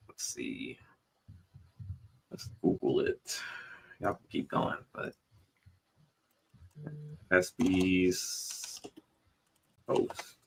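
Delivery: muttering under breath while searching; quiet aside to himself; whispering. Real, not acted. Searching on phone, aside to self.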